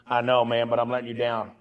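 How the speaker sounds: lightly put-on hillbilly accent, pitched low; low hillbilly confession, retold. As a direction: imitate a contrite hillbilly coworker. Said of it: "he's got this really hillbilly accent".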